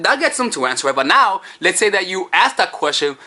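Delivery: normal talking voice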